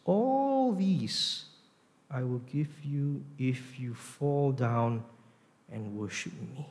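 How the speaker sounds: drawn-out tempting offer, hushed ending; persuasive, polite offer. Acted. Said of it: "he's a bit more persuasive".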